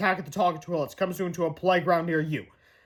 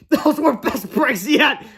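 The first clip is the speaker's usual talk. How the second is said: higher, louder, slow laughing line; laughing boy; 7 semitones higher, 6 dB louder, much slower than usual. Acted. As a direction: giggle it out in triumph. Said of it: "laughed Harold".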